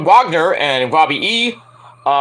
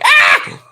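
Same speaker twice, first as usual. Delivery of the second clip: very high, loud shriek; scream of horror; 6 dB louder than usual. Retold or imitated. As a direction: shriek at the mirror. Sees himself in mirror.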